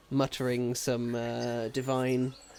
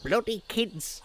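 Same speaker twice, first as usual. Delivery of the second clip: lightly acted grumble, pitched up; mild grumbling; 5 semitones higher, slower than usual.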